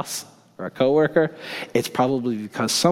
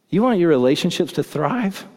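normal talking voice

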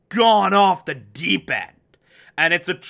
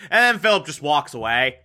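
usual speaking voice

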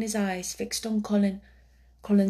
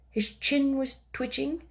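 plain reading voice

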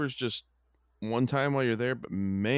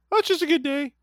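high, loud cartoon mouse voice; Mickey Mouse voice; 6 dB louder than usual. Cheery mouse voice explaining to the boss. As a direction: squeak it out cheerfully.

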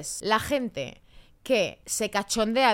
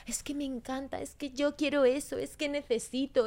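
higher, breathless dreamy fan; gushing fan; 5 semitones higher, 5 dB quieter than usual. Retold or imitated. Mocking social media fans, dreamy higher gushing voice. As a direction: swoon like a fan living through others. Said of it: "idealizan todo de una manera".

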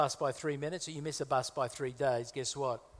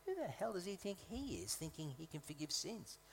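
hushed, higher-pitched indignant thinking aloud; acted silent objection, kept very soft; indignant inner thought; 3 semitones higher, 10 dB quieter than usual. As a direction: grumble it privately, well under your usual volume. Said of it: "they're thinking to themselves".

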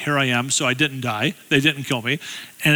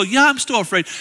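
higher, mildly insistent comeback; acted-out bickering; 7 semitones higher than usual. Wife insisting to her husband, higher mock protest. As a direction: hold your ground in a mock squabble. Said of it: "we actually will have an argument".

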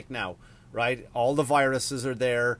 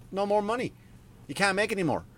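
high-pitched mock voice of money men; mock hedge fund guys; 8 semitones higher than usual. Hedge fund guys cutting off small investors, mocking high voice. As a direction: play the interfering financier, pitched well up.

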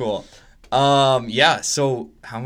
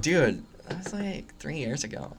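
mock dismissive friend; 10 dB quieter than usual. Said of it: "you're like".

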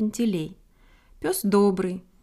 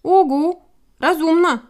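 loud, high hooting owl grumble on «разумно»; sceptical, loud owl voice; grumbling; 8 semitones higher, 9 dB louder than usual. A narrator's reading. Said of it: "проворчал филин Порфырий".

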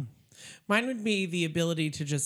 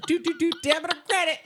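high, loud, rapid sing-out; debit-or-credit machine call; 13 semitones higher, 7 dB louder, faster than usual. Retold or imitated. Retelling her friend's neck-roll prank, high loud mock cashier call. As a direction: belt it out like an excited card machine. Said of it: "And said".

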